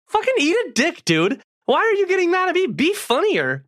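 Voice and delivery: high-pitched, heated ranting; livid rant. Retold or imitated. Comedian venting at the comic who blamed him. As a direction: vent it like you're still furious. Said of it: "I was f-, livid".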